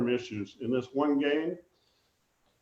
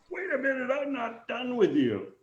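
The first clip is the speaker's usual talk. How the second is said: much higher put-on voice of the angry manager; mock manager calling back; 10 semitones higher than usual. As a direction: call him back, not finished with him yet. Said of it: "suddenly says".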